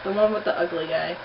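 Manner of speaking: ordinary talk, nothing marked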